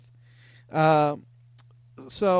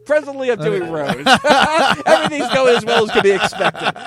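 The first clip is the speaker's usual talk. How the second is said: loud, higher voice through laughter; helpless amusement, pitched up and loud; laughing; 7 semitones higher, 9 dB louder than usual. Real, not acted. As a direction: crack up while repeating your own silly line. Cracks up rereading own message.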